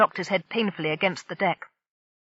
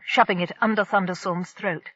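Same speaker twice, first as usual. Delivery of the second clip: ordinary delivery, nothing marked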